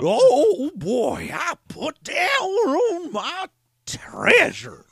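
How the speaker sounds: put-on high slow crying old-timer; old prospector. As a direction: wail over the treasure. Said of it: "old prospector".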